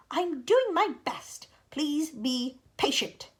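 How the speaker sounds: high, slow pleading voice; strained glowworm. Acted. Glowworm answering the impatient creatures, strained. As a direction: beg them to bear with you. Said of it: "answered the poor glowworm".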